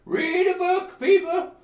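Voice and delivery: put-on high, loud exasperated old hermit; cranky hermit rant. Hermit berating pestering adventurers, high and loud. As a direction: scold the pests like a fed-up recluse.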